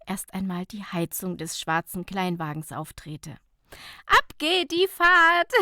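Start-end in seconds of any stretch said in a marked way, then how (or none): 4.1-5.5 high, bright burst of joy, slow; laughing. Acted. Nicola starting the drive to the spa, laughing. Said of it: "lachte Nicola".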